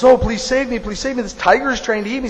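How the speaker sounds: high-pitched mock begging; urgent plea. Dog begs the guru to save him from a tiger, pleading.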